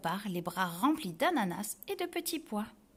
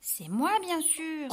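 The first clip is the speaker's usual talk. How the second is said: smug, showy boast; 7 semitones higher, slower than usual. Acted. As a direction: show off, sure you're the best. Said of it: "se vante l'hirondelle". (vantardise suffisante)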